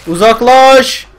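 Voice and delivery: loud, high, slow command to back off; shouted order. Real, not acted. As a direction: drive them away with a yell. Pushing zombies away. (yüksek sesle emir)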